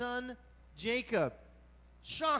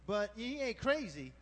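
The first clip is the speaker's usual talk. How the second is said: usual speaking voice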